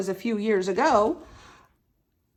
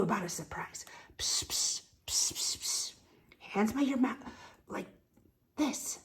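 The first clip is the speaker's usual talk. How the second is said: secretive soft whisper; 12 dB quieter than usual. Acted. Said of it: "and I'll whisper".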